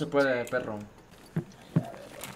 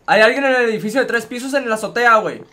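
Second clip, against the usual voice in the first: higher, louder urgent callout; excited callout; 6 semitones higher, 8 dB louder than usual. Real, not acted. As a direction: shout the enemy spot to the squad. Spotting enemy on roof.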